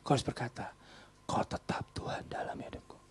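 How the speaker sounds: hushed devotion; soft unvoiced vow; whisper. Shown as a demonstration. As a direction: whisper it as a prayer. Whispered prayer model.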